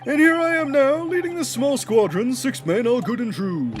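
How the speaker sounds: high, loud rallying speech to the troops; old officer rousing his squadron; blustering military foreman. Acted.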